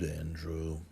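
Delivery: ordinary delivery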